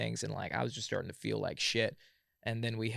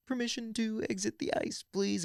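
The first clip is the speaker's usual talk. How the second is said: high-pitched, controlled formal request; imitating a freezing trainee asking permission; strained composure; 11 semitones higher than usual. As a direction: struggle to ask politely without freaking out. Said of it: "verbally say it instead of freaking out".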